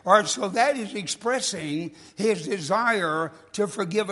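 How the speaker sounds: emphatic Southern preacher's sermon; preaching. Acted. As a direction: preach it with rising fervor.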